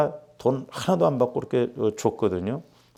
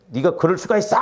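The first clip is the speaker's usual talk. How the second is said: indignant rebuke; 5 semitones higher than usual. Retold or imitated. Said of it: "성령께서 뭐라고 말씀하시냐면".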